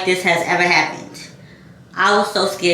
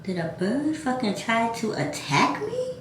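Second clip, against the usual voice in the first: disbelieving mutter; 2 semitones higher, 10 dB quieter than usual. Retold or imitated. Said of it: "I go".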